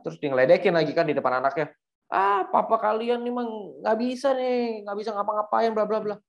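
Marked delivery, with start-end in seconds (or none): 2.1-6.2 mocking taunt. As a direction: sneer at him in front of his kids. Thug mocks Asoy before kids.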